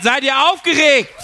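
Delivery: slow, drawn-out crowd call; hype-man shout. Acted. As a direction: whip up the crowd with a stretched question. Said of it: "Nein, nein, er hat gefragt". (anheizender, gedehnter Ruf ans Publikum)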